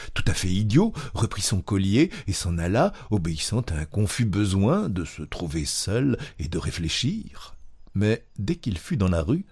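ordinary delivery, nothing marked

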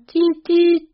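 very high, loud Tit, tit; husband crying out for his dog in the woods, high and loud; high loud call. A narrator's reading. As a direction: cry out Tit, tit like calling a dog. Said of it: "et criant".

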